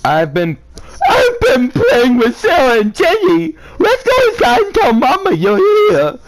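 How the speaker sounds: put-on very high, loud giggly little girl voice; high-pitched laughing child voice. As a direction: squeal it like an excited little girl.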